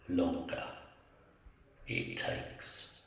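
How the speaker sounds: deep, slow, ominous whisper; ominous guide. Acted. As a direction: drop it low and slow like a threat. Guide's ominous reply in story.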